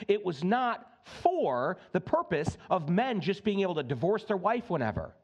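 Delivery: normal talking voice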